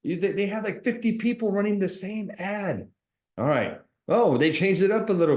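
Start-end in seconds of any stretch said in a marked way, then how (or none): none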